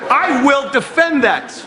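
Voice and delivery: normal talking voice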